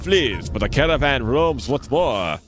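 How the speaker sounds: lightly put-on French accent for the flea leader. Streamer voicing the flea caravan leader to the player, light French accent. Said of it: "That's my attempt at a French accent".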